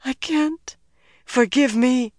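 high, thin plea; pained high voice; anguished apology. A narrator's reading. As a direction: beg forgiveness, voice pitched high. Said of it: "she whispered".

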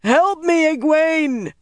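high, loud scream for help; anguished shout at the top of the voice; desperate cry. Acted. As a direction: cry out desperately, pitch right up. Last desperate call for help.

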